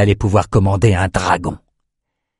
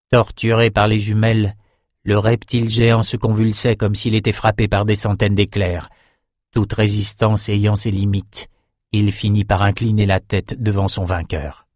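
usual speaking voice

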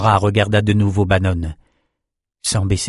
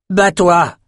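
high, forceful command; bellowed order; 9 semitones higher, 4 dB louder than usual. A narrator's reading. Adessa to the fighter in the pit, bellowing. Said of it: "beugla Adessa".

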